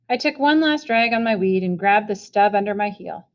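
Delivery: usual speaking voice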